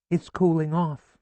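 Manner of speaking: high, fussy mother voice; a mother fretting aloud in a high voice; chatty mother, pitched high; mother's voice. A narrator's reading. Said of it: "my mother says".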